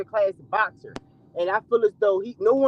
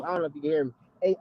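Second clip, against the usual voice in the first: ordinary delivery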